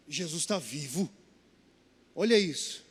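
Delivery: low and slow, as if it just dawned; awestruck. Retold or imitated. Young man realizing Jesus lives, low awestruck. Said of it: "Ele falou".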